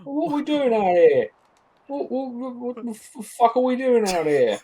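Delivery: high, hammed-up Cockney line; over-the-top fake Cockney; mock Cockney. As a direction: play a cheeky Cockney kid, stumbling and laughing. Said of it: "I'm not ready with a Cockney accent".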